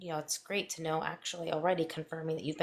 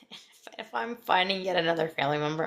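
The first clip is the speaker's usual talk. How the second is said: normal talking voice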